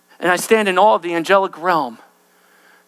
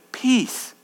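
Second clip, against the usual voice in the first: slower, softer blessing; gentle peace; 3 dB quieter, slower than usual. Acted. Angel answers Daniel's pain, slow and soft. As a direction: settle it gently.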